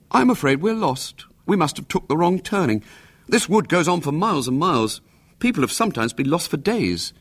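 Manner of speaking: a lightly voiced schoolboy, offhand; casual boy. A narrator's reading. William faking a casual warning to his aunt. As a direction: toss it off as if it's nothing. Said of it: "said casually".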